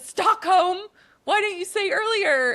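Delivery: high, loud panicked outburst; freaking out. Acted. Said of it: "Candela freaks out".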